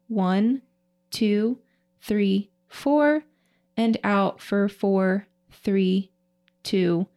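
slow, paced breathwork counting; slow breath counting. Shown as a demonstration. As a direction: count the breaths out slowly and evenly. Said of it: "we'll go ahead and inhale through the nose".

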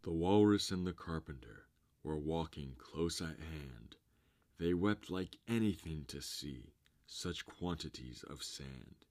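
plain reading voice

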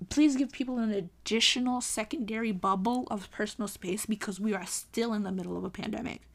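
usual speaking voice

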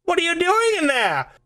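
high, tentative call through a trailer door; puzzled assistant calling in; crew member calling. Acted.

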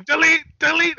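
high, loud mock wrestler chant; imitated yelling. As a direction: yell it like a catchphrase. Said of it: "he starts yelling".

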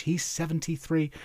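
ordinary talk, nothing marked

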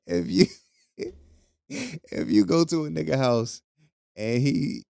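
high, breaking into laughter mid-question; cracking up as he asks the ladies; laughing. Real, not acted. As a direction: laugh through the words.

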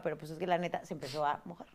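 low, hushed confession; quiet voice. Real, not acted. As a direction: drop the voice, embarrassed. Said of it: "¿Por qué lo dices en voz baja". (en voz baja)

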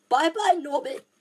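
high, tearful farewell to the dragon; sobbing goodbye. A narrator's reading. Hagrid sobbing farewell to baby dragon Norbert, high and tearful. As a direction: choke out the goodbye like a big softie. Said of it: "Hagrid sobbed".

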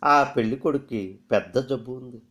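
ordinary delivery, nothing marked

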